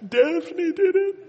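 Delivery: put-on high, slow little boy voice with a laugh; tattling toddler. Son blaming his sister Daphne to his father.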